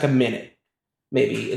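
ordinary talk, nothing marked

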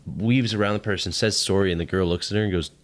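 normal talking voice

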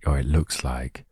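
normal talking voice

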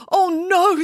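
high, emphatic exclamation; excited storybook nanny; excited denial. A narrator's reading. Excited nanny answers worried child.

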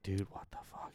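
hushed, breathless whisper to his coworker; scared whisper. Retold or imitated. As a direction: whisper it like you're hiding from someone. Hiding from the creepy woman.

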